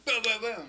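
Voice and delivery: loud, high boom-boom-boom; rapid-fire vocal booms; imitated gunfire. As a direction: punch out mock gunshots. Imitates gunfire in a war anecdote.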